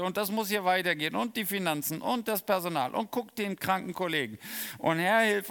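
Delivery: mock-exasperated rant while out jogging; scolding complaint. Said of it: "Und dann bin ich am Schimpfen".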